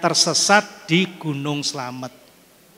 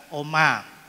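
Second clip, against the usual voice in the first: ordinary talk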